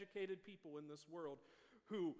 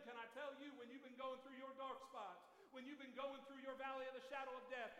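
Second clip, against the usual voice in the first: ordinary talk, nothing marked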